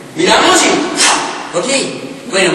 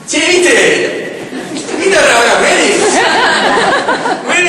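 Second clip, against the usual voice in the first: excited; 10 semitones higher, 3 dB louder, slower than usual. Acted. Excited Argentine artist persona.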